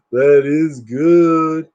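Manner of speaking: very slow, stiff delivery; very slow stiff mock line; hero line. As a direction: savor it awkwardly. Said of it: "He goes".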